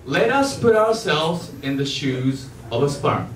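ordinary talk, nothing marked

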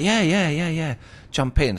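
mock-eager, easygoing invitation; eager yeah. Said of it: "And he was like".

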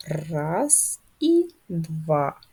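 very slow, drawn-out counting with pauses; teacher counting along with slow writing movements; slow stroke count. Shown as a demonstration. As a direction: stretch each word to last a pen stroke. Repeats slow count for air writing.

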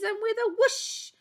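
high, punchy whoosh; dramatic whoosh. A narrator's reading. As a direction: swoop the voice up on whoosh. Whoosh of the sneeze.

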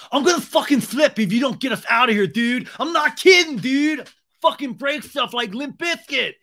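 high, loud mock rant; hammed-up furious outburst; tantrum threat. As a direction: blow up like a whining bro. Acted Tom threatening to flip.

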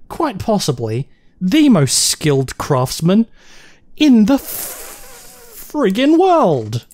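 high, drawn-out incredulous outburst; exasperated disbelief. Real, not acted. Player finds the front door cemented over, exasperated disbelief. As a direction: stretch the words in exasperated disbelief.

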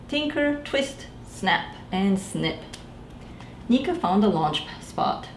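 ordinary delivery

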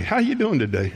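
high, bright greeting; cheerful greeter; cheery greeting. Acted. Acted greeting.

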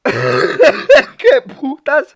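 stretched-out laughing exclamation; laughing disbelief. Real, not acted. Streamer laughing at a clip title, stretched disbelief. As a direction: laugh through it, shocked.

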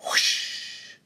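long, airy whooshing noise; whispered rush of air; arrow whoosh. A narrator's reading. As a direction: imitate an arrow flying past with pure breath. Imitated flying arrow.

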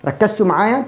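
normal talking voice

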